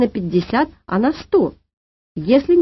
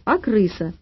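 mildly displeased, in an everyday pitch; a touch of grumpy correction; slower than usual. A narrator's reading. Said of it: "недовольно сказала Маня". (слегка недовольно)

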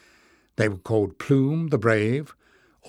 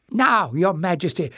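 urging; 6 semitones higher than usual. Acted. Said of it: "urged Joseph".

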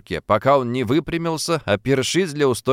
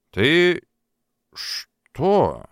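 squeezed out with difficulty; 6 semitones higher than usual. A narrator's reading. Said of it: "с трудом выдавил Африканов из себя".

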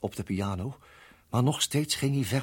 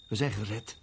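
usual speaking voice

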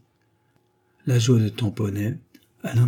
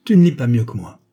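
louder, irritated retort; annoyed; 8 dB louder than usual. A narrator's reading. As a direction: throw it back, irked. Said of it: "Le mari, agacé". (réplique agacée)

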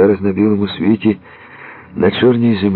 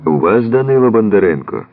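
insistent calling; 5 semitones higher, 3 dB louder than usual. A narrator's reading. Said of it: "Рука настирливіше дзьобає шибку".

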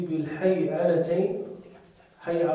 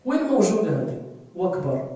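ordinary talk, nothing marked

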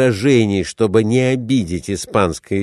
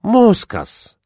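exclaiming; 12 semitones higher than usual. A narrator's reading. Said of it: "воскликнул капитан".

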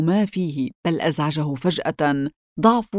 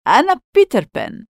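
high-pitched triumphant announcement; jubilant, raised cry; gleeful shout; 7 semitones higher, 4 dB louder than usual. A narrator's reading. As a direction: proclaim your name with glee, pitch well up. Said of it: "فصاح بيتر ضاحكا".

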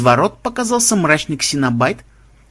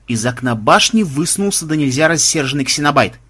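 ordinary delivery, nothing marked

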